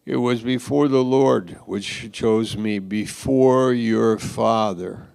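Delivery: "your father" slowed and pressed; pointed emphasis. Retold or imitated. David answering Michal with a pointed jab at her father. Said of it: "he said it like this".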